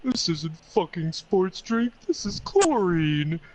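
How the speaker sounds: high, slow mock wail; imitating a friend bawling in the pool; crying. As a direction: wail it in drawn-out, tearful disappointment.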